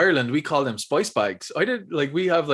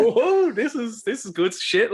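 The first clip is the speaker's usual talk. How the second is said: amazed first sip; 9 semitones higher, slower than usual. Retold or imitated. Retelling first sip reaction.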